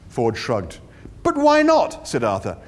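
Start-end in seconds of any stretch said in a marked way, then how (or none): 1.2-2.1 high, louder, urgent; pleading protest. Acted. Arthur protests.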